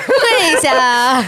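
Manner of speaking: hammed-up commanding shout. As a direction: belt it out as an order, half laughing. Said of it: "他那种命令性的口吻".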